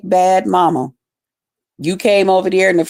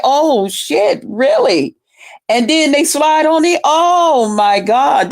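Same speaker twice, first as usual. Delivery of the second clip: high, thrilled reaction; excited; 7 semitones higher, slower than usual. Real, not acted. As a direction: react like it's juicy news.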